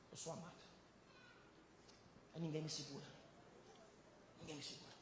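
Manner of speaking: soft, low, intimate voice; hushed self-assurance. Acted. Believer quietly affirming he is loved, hushed self-assurance. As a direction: say it low, as if to yourself.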